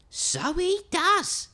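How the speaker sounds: drawn-out agreement; little skeleton agreeing. Acted. Little skeleton agrees the dog looks odd, high and slow.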